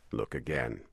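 low, steady captain voice; low calm captain. A narrator's reading. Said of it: "He always spoke in a low voice and calmly".

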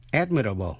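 "Admirable" stressed on AD, falling away; teacher's model of "Admirable", weight up front; first-syllable stress. Shown as a demonstration. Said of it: "đều nhấn mạnh phần đầu".

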